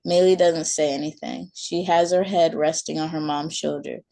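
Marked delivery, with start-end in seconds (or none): none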